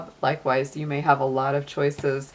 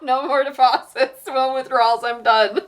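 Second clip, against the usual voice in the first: higher-pitched mock refusal, breaking into laughter; fed-up bank teller; 7 semitones higher than usual. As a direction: play a teller shutting the window, laughing. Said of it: "you're like the bank teller closing the window".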